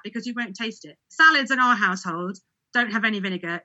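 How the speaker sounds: ordinary delivery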